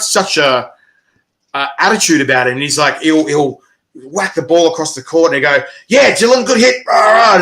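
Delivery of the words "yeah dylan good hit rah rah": loud, higher mock self-cheer; imitated self-talk shout. Tennis player praising himself after a good hit, mock shouting. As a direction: cheer yourself on like a player mid-match. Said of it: "like, shouting it".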